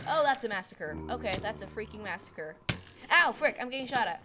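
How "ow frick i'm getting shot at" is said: higher, much louder yelp under fire; pained yelp. Real, not acted. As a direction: yell as the bullets hit. Getting shot in game.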